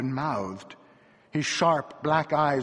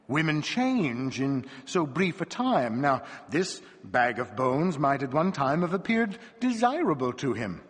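usual speaking voice

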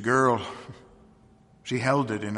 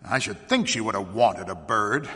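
a touch of bitterness, lower pitched; almost bitter; 3 semitones lower than usual. A narrator's reading. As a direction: say it with understated bitterness. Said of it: "almost bitterly".

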